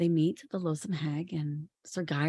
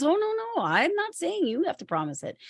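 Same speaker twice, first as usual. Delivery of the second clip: mock high hag protest; 12 semitones higher than usual. Hag corrects King Arthur.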